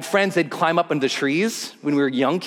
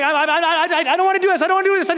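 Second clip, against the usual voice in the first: panicked higher pleading; 10 semitones higher, much faster than usual. Retold or imitated. Scared skydiver begging his instructor not to jump, higher. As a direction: beg to get out of it, panicking. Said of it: "I'm like".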